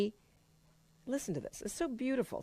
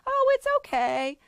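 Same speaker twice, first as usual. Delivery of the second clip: much higher, breezy "Oh, it's okay" brushing it off; dismissive mock comfort; 3 dB louder than usual. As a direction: brush the problem off lightly. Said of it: "not gonna just say".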